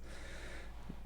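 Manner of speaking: quiet unvoiced chuckle of disbelief; breathy laugh at the absurdity; soft laugh. Real, not acted. Reader laughing at the armed welcome, soft chuckle. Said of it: "You're pointing guns at people".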